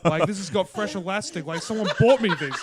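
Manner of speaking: laughing through baffled questions. Retold or imitated. Waking up in fresh underwear, baffled and laughing. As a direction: giggle at your own mystery underwear. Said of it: "and you're like".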